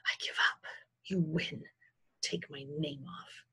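hushed, defeated whisper. A narrator's reading. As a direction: whisper it in surrender. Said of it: "sat down and whispered".

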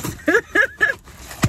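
high, loud burst of giggles. Real, not acted. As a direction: laugh out at the stuck tape. Laughing at taped package.

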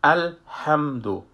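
slow teaching recitation of الحمدو; sukun on lam. Shown as a demonstration. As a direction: close the lam in الحمدو without a vowel.

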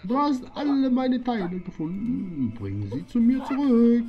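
high, bright voice for the game figure; high character voice. Pretend. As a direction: squeak it out as a little creature.

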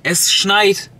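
loud, high "it's snowing!"; surprised exclamation. Real, not acted. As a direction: blurt it out in disbelief. Surprised at April snow.

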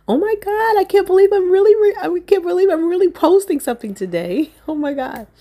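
high loud excited gush; can't contain the thrill; real excitement. Real, not acted. Thrilled at posting after years.

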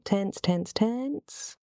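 repeated words stepping up in pitch; building tension. Real, not acted. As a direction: urge the squeeze tighter, rising to the last word. Coaching calf squeeze.

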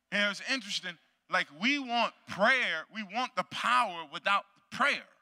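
loud, ringing sermon with big peaks; full-force preaching. Acted. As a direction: preach it hard to the church.

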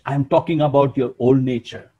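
ordinary talk, nothing marked

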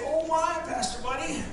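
hushed high oh my; quiet. Retold or imitated. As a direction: say it softly, a bit stung. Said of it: "they'll quietly say".

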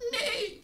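high, falling whinny; imitated high-pitched neigh; horse neigh. A narrator's reading. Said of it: "said the horse".

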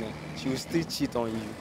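usual speaking voice

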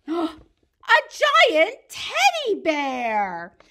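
big storybook amazement; amazed. Acted. Eddie discovers a giant teddy, amazed.

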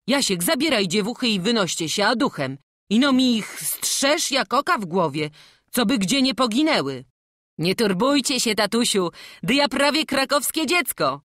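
bright eager lad speaking to the family; character voice. Acted. Young church helper inviting the family in, eager.

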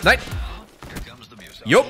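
ordinary talk

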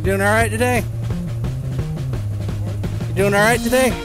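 high, friendly-stranger call with rising question; calling to another rider. Real, not acted. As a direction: call over to the next rider, pitched high.

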